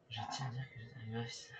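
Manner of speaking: breathy whispered aside; hushed whisper to the chat. Real, not acted.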